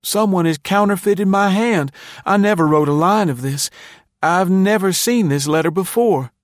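put-on high, loud, wronged boy pleading his case; impassioned high-voiced appeal; feeling, wounded protest. A narrator's reading. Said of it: "then said, feelingly".

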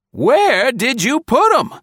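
very high, loud, neighing man's voice; high neighing demand. A narrator's reading. Colonel shouts at the woman through the door, high and neighing. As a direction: neigh it out, gusty with passion. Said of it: "demanded his neighing voice".

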